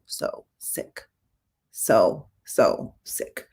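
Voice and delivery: hushed whisper, almost no voice. Real, not acted. Nurse recalling patients who were so sick, whispered. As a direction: breathe the words out, heavy with what she saw.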